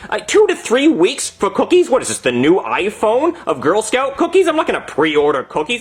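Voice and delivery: high, incredulous complaint; indignant rant. Acted. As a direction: question it in rising outrage. Outraged rant over cookie wait.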